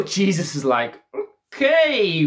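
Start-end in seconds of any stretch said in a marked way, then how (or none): 1.5-2.2 high, louder taken-aback okay; mock startled okay; surprised okay. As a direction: caught off guard. Jesus caught off guard.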